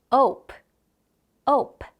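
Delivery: ope said slowly and clearly; phonics demo. Shown as a demonstration. Teacher demonstrating ope for children to copy. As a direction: model ope for kids to repeat. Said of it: "이거 어떻게 발음하지".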